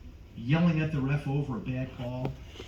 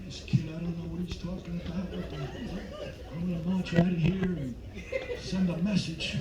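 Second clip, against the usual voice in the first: grumbling audience member; 3 semitones higher, 6 dB quieter than usual. Acted. Acting out departing audience members grumbling.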